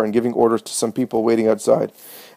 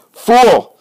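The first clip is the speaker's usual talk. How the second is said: higher, louder, spat out in scorn; furious shout; 11 semitones higher, 8 dB louder than usual. A narrator's reading. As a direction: bark the insult at him. Pharisees scorn the healed man.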